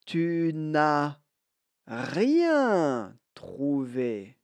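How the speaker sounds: very slow, drawn-out model phrase; slow model sentence. Shown as a demonstration. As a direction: stretch each word out for learners to repeat. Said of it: "écoutez bien et répétez".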